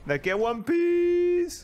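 high, drawn-out hype call; excited rising holler; hyped cry. Real, not acted. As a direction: stretch the last word into a high wail. Hyped repeat, stretched into a cry.

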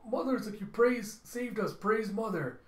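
high-pitched, eager grovelling; fawning minion. Acted. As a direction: praise your master gushingly. Minion fawns over villain Mother.

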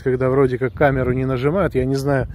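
normal talking voice

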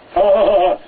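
loud, high ha-ha-ha-ha; acted laugh. A narrator's reading. Robespierre laughs at the plan, a loud acted laugh. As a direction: laugh it out in hearty syllables. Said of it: "lacht Robespierre".

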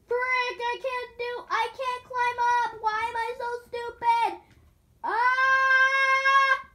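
high, loud fake raging yell; mock rage. Gamer ham-raging for the viewers, loud and high. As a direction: ham up the frustration for laughs. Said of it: "just pretending like I am".

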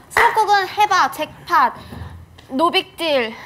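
home shopping host. Pretend. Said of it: "홈쇼핑처럼 막 해줘야 돼요".

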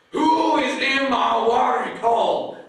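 high, loud furious bellow; angry crocodile shout. Acted. Crocodile shouting at the intruder at her watering hole, furious shout. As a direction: roar it out as the outraged crocodile.

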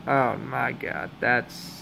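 slow, low and soft, crushed; quietly defeated fan, trailing away; deflated disbelief. Real, not acted. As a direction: let the voice sink as hope drains away. Opponent scores, he is crushed.

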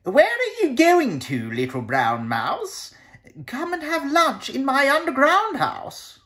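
high, smooth and sly, a hungry fox luring. Acted. Fox invites the mouse to lunch, slyly high.

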